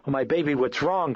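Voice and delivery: high, loud frightened cry; alarmed exclaim. Acted. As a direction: rush in, scared for your child. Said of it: "Chris exclaimed".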